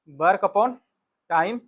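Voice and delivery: usual speaking voice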